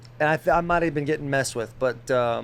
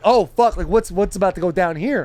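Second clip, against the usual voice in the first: loud, higher-pitched retold panic; alarmed outburst; 4 semitones higher, 6 dB louder than usual. Storyteller reliving the reader going off, alarmed. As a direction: blurt it out loudly like you dread what's coming. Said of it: "And I was like".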